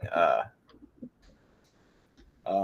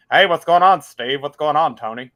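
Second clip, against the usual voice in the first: Dark Helmet voice; 2 semitones higher, 7 dB louder than usual. Pretend. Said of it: "I'll speak on his behalf".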